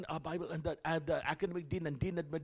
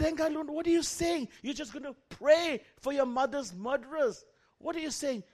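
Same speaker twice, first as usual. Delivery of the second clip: high, loud incredulous plea; can't believe what you hear; astonished; 10 semitones higher, 4 dB louder than usual. Retold or imitated. Teacher stunned that the student will only pray, high incredulous voice.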